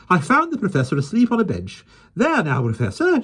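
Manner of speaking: high, excited lady's voice; Miss Lark voice. A narrator's reading.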